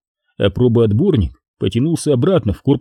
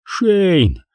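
panicked yell; 7 semitones higher than usual. A narrator's reading. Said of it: "заорал благим матом".

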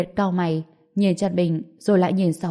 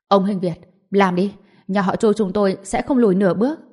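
plain reading voice

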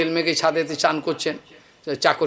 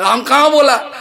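indignant master; 8 semitones higher, 6 dB louder than usual. Retold or imitated. Master denies to servant.

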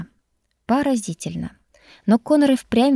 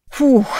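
loud breathless snort; snorting puff; 5 semitones higher, 7 dB louder than usual. A narrator's reading. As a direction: blow out a tired huff. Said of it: "фыркнул он".

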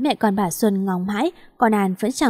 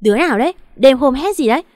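louder, sour scolding challenge; shrewish yell; 4 semitones higher, 6 dB louder, faster than usual. A narrator's reading. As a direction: snap at a late-night intruder, voice raised. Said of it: "chanh chua hét". (quát chanh chua, cao giọng)

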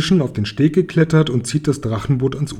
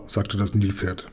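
ordinary delivery

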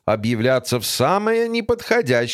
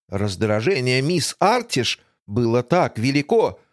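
usual speaking voice

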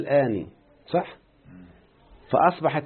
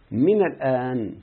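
من الآن drawn out slowly; slow recitation; much slower than usual. Shown as a demonstration. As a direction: stretch من الآن slowly, stressing من. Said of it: "سمعنا يا شيخنا؟". (تلاوة بطيئة لـ"من الآن" بضغط على "من")

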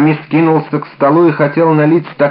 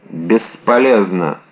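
slow, low, hopeless word; grave resignation; 6 semitones lower, slower than usual. A narrator's reading. Athos seeing no hope for the poisoned woman, resigned. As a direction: let the word fall heavily, low and slow.

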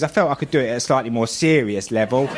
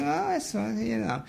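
peeking remark; 6 semitones higher, 9 dB quieter than usual. Retold or imitated. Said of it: "and go".

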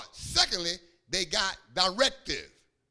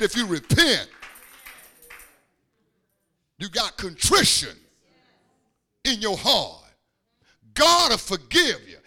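loud preacher's shout in short bursts; full-force preaching; 4 semitones higher, 10 dB louder than usual. Real, not acted. Preacher promising forgiveness to the congregation, full-force preaching. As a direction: proclaim each phrase forcefully, with big pauses.

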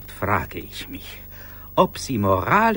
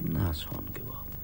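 hushed reveal; 8 semitones lower, 10 dB quieter than usual. Acted. Reluctant hushed revelation.

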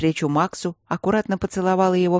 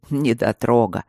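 grumbled jibe; 5 semitones lower than usual. A narrator's reading. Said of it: "пробурчал барон".

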